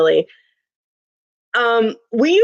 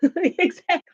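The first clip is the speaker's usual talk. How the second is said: ordinary delivery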